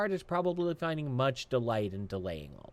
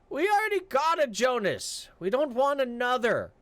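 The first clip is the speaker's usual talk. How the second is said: mocking guard; 12 semitones higher, 3 dB louder than usual. Guard stonewalling Jonas at the gate, high and taunting. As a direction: stall him with fake ignorance.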